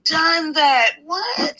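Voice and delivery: cries it out with a burst of joy; excited shout. Real, not acted. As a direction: shout the victory line. Triumphant close of a hype speech.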